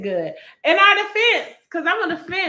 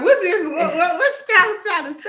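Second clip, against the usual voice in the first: mock startled; 5 semitones higher, 6 dB louder than usual. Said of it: "I got scared".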